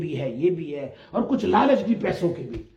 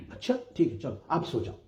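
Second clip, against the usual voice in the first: softer, lower, calming an upset man; soothing elder; 7 semitones lower, 9 dB quieter, faster than usual. Retold or imitated. Elder calming his angry disciple, soft and soothing. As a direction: hush him gently toward sleep.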